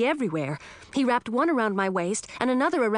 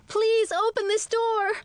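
pleading yell; 14 semitones higher than usual. A narrator's reading. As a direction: beg at the top of your voice. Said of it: "I yelled".

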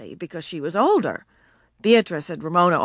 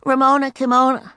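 put-on girl voice; 7 semitones higher, 4 dB louder than usual. A narrator's reading. Beezus complaining in story.

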